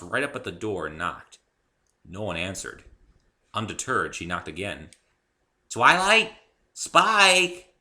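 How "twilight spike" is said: high, loud bright call to the house. A narrator's reading. Pinkie calling at the library door, loud and high. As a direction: call out for Twilight and Spike. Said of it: "she called".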